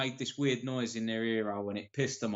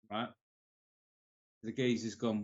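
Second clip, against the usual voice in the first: ordinary delivery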